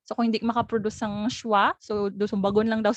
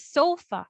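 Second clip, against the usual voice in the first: "sofa" as SO-fa, falling away after SO; first-syllable stress; 5 semitones higher than usual. Shown as a demonstration. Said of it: "the stress there is on the first syllable".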